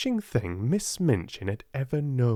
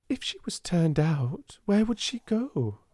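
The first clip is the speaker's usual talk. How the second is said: higher, a touch of worry in the question; a trifle anxious; 9 semitones higher than usual. A narrator's reading. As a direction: ask it with mild unease. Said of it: "a trifle anxiously".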